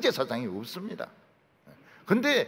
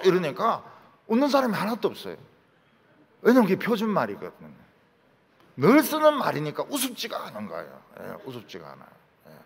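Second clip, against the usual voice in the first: usual speaking voice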